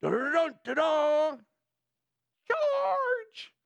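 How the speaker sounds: sung trumpet fanfare then a high yelled charge; trumpet call and charge. Retold or imitated. Pastor imitates battlefield trumpets with a hammed-up bugle call and charge. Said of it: "They have trumpets for what?".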